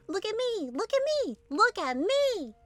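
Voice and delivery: high, sing-song look at me; attention-seeking ostrich call. Acted. Ostrich Sniffles calling the others to notice her, high show-off. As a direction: show off for everyone, high and bright. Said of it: "call to the others".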